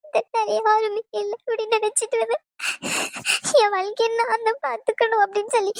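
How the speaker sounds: high, halting voice close to tears; tearful thanks. Acted. Girl thanking her new mother while on the verge of tears. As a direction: choke the words out, barely holding back crying.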